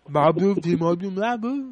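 "Marble, T-Mobile, marble" as "mah-boo, tee-mah-boo, mlah-boo"; mushy baby-talk pronunciation. Retold or imitated. Host mocks how Prankmaster talks, "Marble" as "mah-boo". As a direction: babyish garbling, ends of "Marble" and "T-Mobile" become "boo". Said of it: "That's how he talks".

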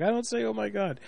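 imitated protesting wife. Wife answering her teasing husband, imitated high soft protest. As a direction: mimic her high, hushed denial. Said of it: "She's like".

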